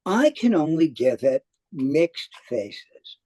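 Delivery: ordinary talk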